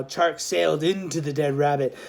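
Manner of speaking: ordinary delivery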